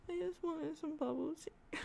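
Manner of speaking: high, slow, pitiful little plea; mock tearful. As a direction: act out crying over a small craving, softly. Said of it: "tears streaming down my face".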